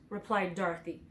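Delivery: plain reading voice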